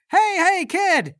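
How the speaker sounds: put-on high, loud hail to a passing kid; calling out. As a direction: shout to flag down a kid. Hailing a passing boy.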